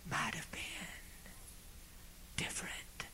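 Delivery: soft whispered line; breathy fading whisper. Real, not acted. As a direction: let it fade to a whisper. Whispered saddest words.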